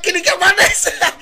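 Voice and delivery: high repeat through laughter; laughing. Real, not acted. Host asking the guest back, a high laughing repeat. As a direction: ask again, laughing. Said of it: "repeated laughing".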